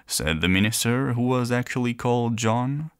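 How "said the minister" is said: plain reading voice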